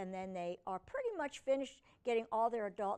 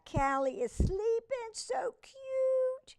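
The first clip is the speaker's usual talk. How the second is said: much higher cooing over a sleeping kitten; 13 semitones higher, 3 dB louder, slower than usual. Real, not acted.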